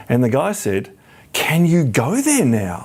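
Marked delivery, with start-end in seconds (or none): 1.3-2.8 slow, puzzled American asking; lightly voiced bewildered barfly; naive American. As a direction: play the clueless New Yorker. American asks naive question at bar.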